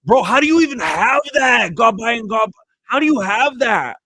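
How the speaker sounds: high, loud real disbelief; amazed outburst. Real, not acted. Streamer astonished by someone's unopened find, loudly incredulous. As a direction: exclaim in astonishment, voice shooting up.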